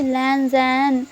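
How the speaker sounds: a hint of moaning, said very slowly; drawn-out moan of the name. Wing moans Lanzhan's name, lightly voiced, slow. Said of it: "लैनजन का नाम लेकर morning कर रहा था".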